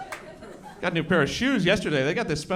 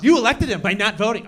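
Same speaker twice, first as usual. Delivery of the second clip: mock angry voter; 5 semitones higher, 7 dB louder than usual.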